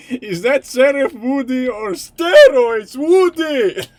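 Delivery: high, loud, slow disbelieving outburst; hammed-up shocked dad at a knockoff toy. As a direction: demand an answer, scandalised.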